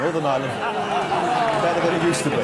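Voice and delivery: chuckling out the punchline; laughing. Real, not acted. Host laughing at priest poster.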